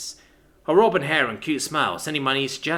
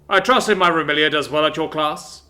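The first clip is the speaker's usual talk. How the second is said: suspicious father voice; 3 semitones higher, 7 dB louder than usual. Acted.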